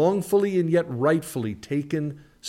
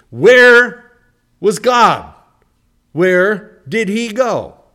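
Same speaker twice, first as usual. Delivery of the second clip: anguished cry; 9 semitones higher, 14 dB louder, much slower than usual. Acted. Voicing the cry of sufferers.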